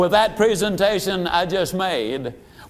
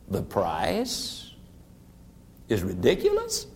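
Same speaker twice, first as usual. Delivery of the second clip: "The price is ridiculous?" repeated much lower and softer, gentle rise on "ridiculous"; 11 semitones lower, 8 dB quieter than usual. Shown as a demonstration. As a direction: drop the voice low and hand "ridiculous" back as a quiet question. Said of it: "you simply lower your voice and repeat".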